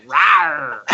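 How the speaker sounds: a quick mock alien "rawr"; mock alien roar. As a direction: pop out with a silly alien growl. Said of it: "an alien just pops out of nowhere and goes".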